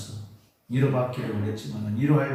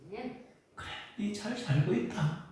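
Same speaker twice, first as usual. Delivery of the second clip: whisper; 8 semitones higher, 7 dB quieter, slower than usual. Shown as a demonstration. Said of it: "내가 나를 위로해야 되겠다".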